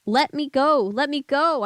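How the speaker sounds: put-on trapped boy pleading; desperate plea. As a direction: beg to be released. Jerry struggles in grip.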